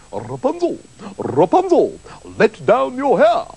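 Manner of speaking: theatrical prince's call, rising at the end. A narrator's reading. Prince calls up to Rapunzel's window, theatrical rising call. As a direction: call up grandly, rolling into the name. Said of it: "the prince went to the tower and called out".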